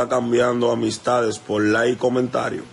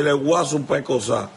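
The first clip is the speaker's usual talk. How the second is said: mocking retort; 5 semitones higher than usual. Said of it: "le dije".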